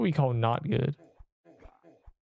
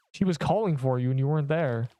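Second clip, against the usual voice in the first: ordinary delivery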